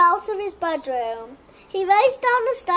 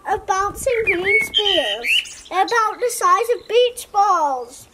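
ordinary delivery, nothing marked

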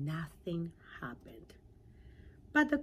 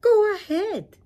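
much higher, much louder eager cry; excited pollywog; 14 semitones higher, 16 dB louder than usual. Acted. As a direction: urge her on, bursting with eagerness. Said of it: "the pollywog said excitedly".

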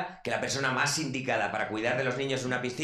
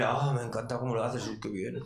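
soft, breathy mock lover's coo; hammed-up bedroom voice; mock sexy murmur; 4 semitones lower, 8 dB quieter than usual. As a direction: coo it like a lover in bed, fading to a whisper.

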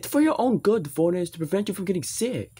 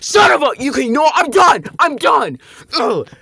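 loud, high exasperated yelling; loud furious quitting; fed-up shout; 9 semitones higher, 12 dB louder than usual. Acted. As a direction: throw up your hands and yell. Gives up angrily.

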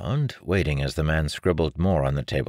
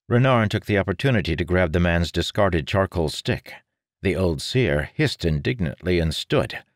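usual speaking voice

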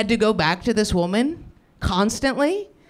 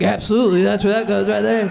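mock eager gambler. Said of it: "And they're like".

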